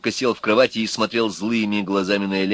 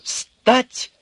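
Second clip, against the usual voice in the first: authoritative command. A narrator's reading. Bespectacled man ordering Urka up, commanding. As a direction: command him to his feet. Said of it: "скомандовал очкарик довольно властно".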